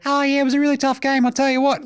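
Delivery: very high, put-on interview voice; Karl Anthony Towns's other voice. Host imitates player discussing a tough game, high second voice. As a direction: jump way up into a squeaky-high polite voice. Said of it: "literally two different voices that they talk in".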